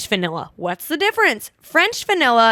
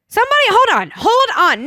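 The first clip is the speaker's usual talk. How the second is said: flustered exclamation; 11 semitones higher, 5 dB louder than usual. Real, not acted. Frantically recalling what she was told.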